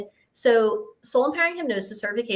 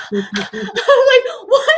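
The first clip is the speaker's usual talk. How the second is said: laughing then a stunned what; real laugh of surprise; laughing disbelief; 9 dB louder, much slower than usual. Real, not acted. Shocked by skeptic's answer.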